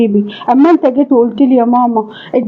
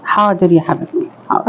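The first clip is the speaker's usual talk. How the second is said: lower, softer, giving in; defeated submission; 6 semitones lower, 4 dB quieter than usual. Acted. Mother-in-law gives in to daughter-in-law, soft and defeated. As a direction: yield with wounded resignation.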